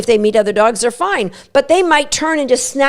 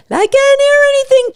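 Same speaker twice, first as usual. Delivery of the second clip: much higher, strained squeak of a voice; a dog too scared to listen; frightened dog's voice; 3 dB louder than usual. Retold or imitated. Terrified dog answering its owner: high, strained squeak.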